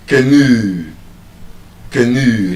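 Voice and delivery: canoe as kuh-NOO, lingering on oo; long oo at end. Shown as a demonstration. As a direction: stretch the last sound of canoe. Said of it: "There's a long oo sound at the end".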